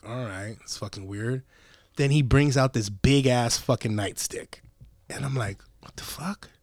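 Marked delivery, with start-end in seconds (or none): 0.1-1.4 very soft, low murmur; muttered aside. Retold or imitated. Driver to himself as the cop's door opens, muttered low. Private reaction to cop stopping. 5.8-6.5 hushed, breath-only whisper; whispered disbelief. Retold or imitated. Driver to himself on seeing the nightstick, whispered.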